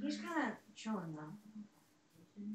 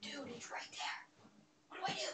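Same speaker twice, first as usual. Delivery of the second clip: frightened whisper, hushed and breathless; scared whisper; 9 dB quieter than usual. Real, not acted. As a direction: whisper so it won't hear you. Monster right there, hiding.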